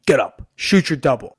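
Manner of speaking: loud, punchy retold command; coach's barked orders. Coach ordering a worn-out wrestler back up, loud and curt.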